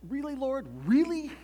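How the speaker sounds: high, incredulous question to God; mock-exasperated appeal; incredulous plea. Pastor acts out incredulous plea.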